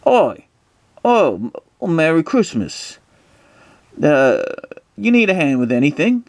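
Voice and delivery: high, hammed-up Cockney accent; [name]. [name] greeting a driver at Christmas, high mock Cockney. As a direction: offer help in a put-on British accent. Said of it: "British accent here".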